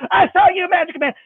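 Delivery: put-on very high little-girl gotcha; triumphant child. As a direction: catch him in the act, squealing. Sarah catches him again.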